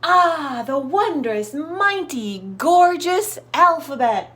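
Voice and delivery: high, slow, awed marveling. A narrator's reading. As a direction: gasp in wonder at the letters. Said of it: "she marveled".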